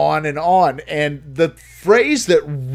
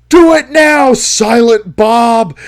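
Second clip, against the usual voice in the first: much louder and higher, a full-throated yell; yelled command; 8 semitones higher, 10 dB louder, slower than usual. Retold or imitated. Crank yelling a signal to his accomplice, loud and high. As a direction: scream the order into the mic. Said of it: "leans into the mic and yells".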